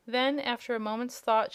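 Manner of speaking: plain reading voice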